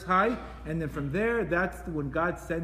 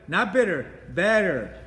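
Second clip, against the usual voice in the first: "bitter" and "better" punched louder, each word set apart; louder, clipped contrast of the two words; stress the contrast; 5 dB louder, slower than usual. Shown as a demonstration. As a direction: hit Not bitter, then better, hard. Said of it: "We don't wanna go from bitter".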